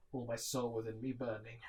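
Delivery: ordinary delivery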